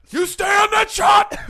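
big shouted command, slowed down; playful shout. Pretend. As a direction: yell it at the cameraman. Shouting at cameraman.

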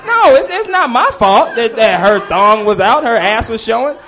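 loud, high-pitched self-defence; indignant protest. Real, not acted. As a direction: protest your innocence to the crowd. Defends himself to disapproving audience.

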